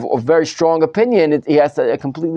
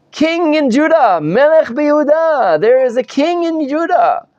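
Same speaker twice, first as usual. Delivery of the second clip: prophets proclaiming a king; 10 semitones higher than usual. Retold or imitated. Teacher voicing the prophets, high ringing proclamation.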